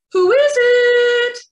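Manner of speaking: very high, loud little pig; high piggy call through the door; squeaky pig voice. A narrator's reading. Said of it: "called the pigs".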